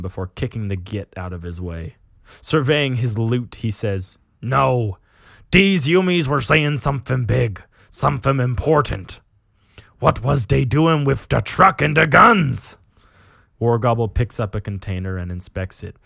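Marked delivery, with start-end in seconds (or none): 4.4-12.8 orc captain puzzling it out, raised voice. Acted. Orc captain surveying his loot, orc voice. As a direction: play a puzzled orc, louder on the key words. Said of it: "you read an orc talking".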